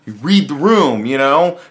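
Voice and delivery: normal talking voice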